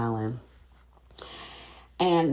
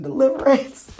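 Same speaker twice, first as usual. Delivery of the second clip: much higher and louder on deliverance; gleeful punchline; 10 semitones higher, 7 dB louder than usual. Real, not acted. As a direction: land the joke with a squeal. Joking call for deliverance.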